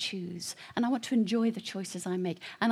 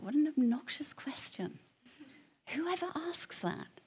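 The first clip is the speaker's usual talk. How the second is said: whispered scorn; 6 semitones higher than usual. Retold or imitated. His retold inner reaction.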